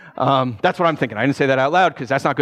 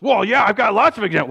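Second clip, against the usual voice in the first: mock indignant; 6 semitones higher, 4 dB louder than usual. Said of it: "angry man".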